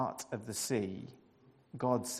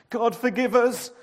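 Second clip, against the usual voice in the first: higher, loud pleading cry; fearful cry; 9 semitones higher, 9 dB louder than usual. A narrator's reading. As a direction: beg for mercy. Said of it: "the sailors cried".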